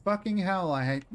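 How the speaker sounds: sudden loud exasperated swear; frustrated curse. Real, not acted. Frustrated at the game.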